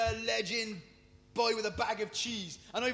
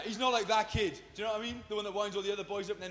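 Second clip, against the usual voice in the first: usual speaking voice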